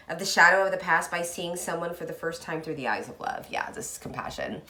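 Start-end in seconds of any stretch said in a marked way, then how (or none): 3.5-4.6 whispered aside. Real, not acted. Soft reflective aside.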